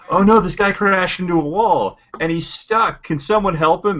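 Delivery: hammed-up panicked bystander, pitched high; cheesy action-movie plea for help; mock alarm. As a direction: cry out in fake distress, high and drawn out. Mocking bystanders in cheesy action scene.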